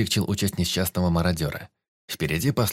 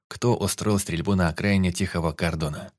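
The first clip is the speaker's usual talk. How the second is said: usual speaking voice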